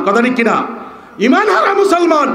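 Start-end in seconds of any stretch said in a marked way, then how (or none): 1.3-2.3 emphatic repeat. Shown as a demonstration. Phrase repeated, higher and louder.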